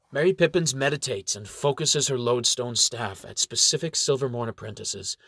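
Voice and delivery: plain reading voice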